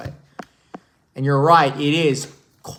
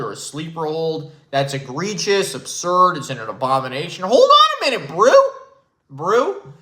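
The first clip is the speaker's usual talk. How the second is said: Nick Wright impression; 3 semitones higher than usual. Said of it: "And my man Nick Wright is here too".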